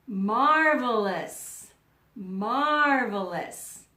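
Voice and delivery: slow, loud, savoured Marvelous; drawn-out marvelous. Shown as a demonstration. Teacher modelling Marvelous for the class, slow and loud. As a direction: stretch out Marvelous slowly and proudly.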